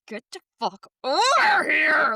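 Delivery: high, loud re-enacted yell; mad. Mother ordering her son to come along, mad shout. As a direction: act out losing your temper at a child. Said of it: "I was so mad".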